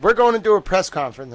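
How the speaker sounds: hammed-up excited socialites; mock eager announcement. Quoting the women's plans.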